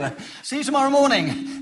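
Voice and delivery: normal talking voice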